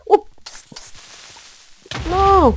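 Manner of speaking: high, loud dismayed no; cri de dépit. Real, not acted. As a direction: cry out as it goes wrong. Loses again in game. (non crié de dépit, aigu)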